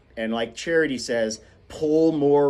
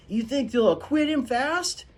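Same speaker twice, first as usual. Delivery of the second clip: put-on high, eager child's question; hopeful child; 13 semitones higher than usual. Jem asking his father hopefully about the verdict. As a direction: ask hopefully.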